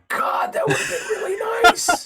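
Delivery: high, loud comic regret with laughter; wistful mock outcry. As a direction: wail it, laughing at yourself. Joking regret over turned-down money.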